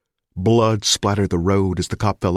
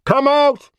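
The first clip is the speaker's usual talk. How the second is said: bullhorn order; 6 dB louder than usual. Acted.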